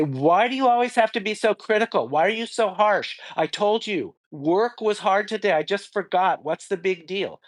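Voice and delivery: high-pitched, defensive acted outburst; acting out an angry, wounded protest; really angry. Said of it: "get really angry and you say".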